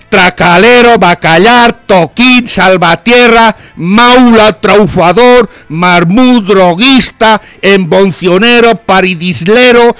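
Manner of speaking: loud, emphatic proclamation, each word hammered out; declaimed list of insults. Acted. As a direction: read out the insults like a town crier. Reads a long list of insults.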